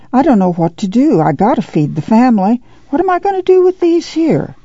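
a touch of helplessness, rising to a high plea at the end; lightly voiced worried mother, at a loss; helpless. A narrator's reading. Ma at the crowded stew pot, not knowing what to do, helpless. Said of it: "Ma said helplessly".

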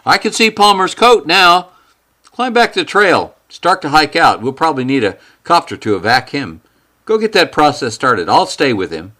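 high, loud orders; commanding tone. Acted. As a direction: bark out instructions. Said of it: "a change in Lee's tone".

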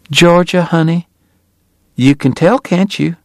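high, lilting Southern lady. A narrator's reading. Southern wife answers her guest.

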